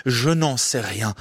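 ordinary delivery

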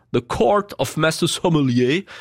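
slow put-on English accent; hammed-up British English accent; cheap English accent. Host naming the British organisation, cheap English accent. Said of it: "cet accent, euh, anglais à deux francs cinquante".

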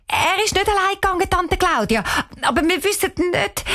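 put-on high, fast and hurried girl's voice; said quickly. Girl hurriedly covering for her brother with her aunt, fast and high. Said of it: "Cheyenne sagt schnell".

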